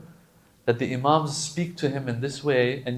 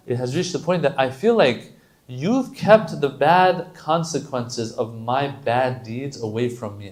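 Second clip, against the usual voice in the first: ordinary delivery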